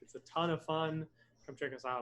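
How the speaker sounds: normal talking voice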